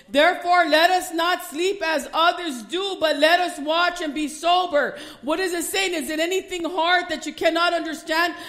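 loud, high, driving sermon voice; preaching at full force. Acted. Preacher urging the congregation, full-force preaching. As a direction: preach it hard and rising.

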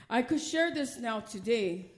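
ordinary talk, nothing marked

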